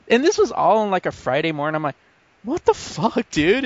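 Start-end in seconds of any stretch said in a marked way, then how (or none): 2.4-3.6 high-pitched baffled complaint; exasperated disbelief. Retold or imitated. Storyteller reacting to the bus stranger on a Friday morning, exasperated.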